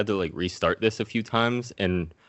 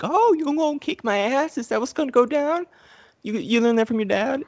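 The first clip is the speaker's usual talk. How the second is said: much higher taunting voice; mocking Sub-Zero; 3 dB louder than usual. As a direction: taunt the kid in a squeaky sneer. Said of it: "and Sub-Zero's like".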